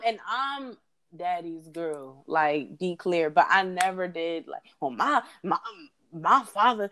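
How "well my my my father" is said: mock southern accent, hammed up. Host mocking people who quote their father, southern accent. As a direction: imitate a put-on southern voice. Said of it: "why you put on a southern accent?".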